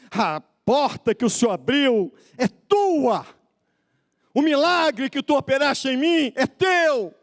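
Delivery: high, forceful cry to God; impassioned preached prayer. Real, not acted. Believer's offering to God voiced by preacher, impassioned prayer. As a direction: belt out the offering, stretching key words.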